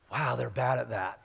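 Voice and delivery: slow, soft muttered "wow, they're bad at that"; quiet amused aside. Jesus quietly judging the fishermen, soft and slow. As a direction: say it under your breath, amused.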